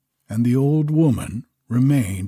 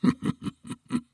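breathy low dragon chuckle; soft mocking dragon chuckle; dragon laugh; 5 dB quieter than usual. A narrator's reading. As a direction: let the dragon chuckle under his breath. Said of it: "The dragon laughed at this".

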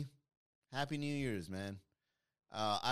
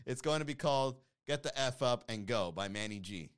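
ordinary talk, nothing marked

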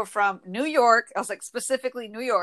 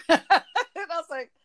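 laughing; 7 semitones higher, 3 dB louder, slower than usual. Real, not acted. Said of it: "laugh heard".

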